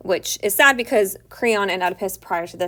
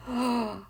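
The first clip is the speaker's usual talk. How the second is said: mock gasp; 4 semitones higher, 6 dB quieter than usual. Teacher reacts to the accusation.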